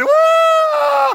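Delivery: very high, loud victory whoop; high triumphant whoop. Retold or imitated. Pastor whoops as his sister runs off. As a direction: whoop like you just won. Triumphant cry in fight story.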